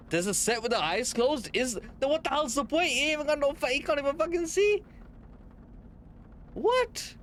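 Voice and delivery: climbing into a high-pitched squawk; incredulous outburst. Real, not acted. Streamer questioning the point of closed-eye toys, incredulous and climbing high. Reacting to creepy toy with closed eyes.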